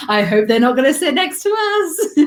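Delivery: high, mock-anxious voice rising through the line; dreading passengers. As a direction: play a passenger squirming with dread, half laughing. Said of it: "the look on the other passenger's face".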